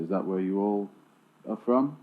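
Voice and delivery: normal talking voice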